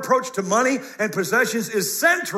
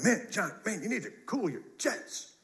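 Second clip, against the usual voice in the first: softer, grumbling church member imitated; mock congregant complaint; 10 dB quieter than usual. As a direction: imitate someone muttering a complaint to the pastor. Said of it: "some of you think I'm rough sometimes. Like".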